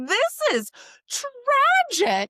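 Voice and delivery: high, slow hammed-up lament; mock tragic. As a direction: wail it like a melodrama. Exaggerated retold dismay.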